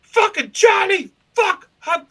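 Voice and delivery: very high, loud cursing yell; furious shout. Retold or imitated. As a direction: scream at the producer in a rage. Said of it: "And I'm like".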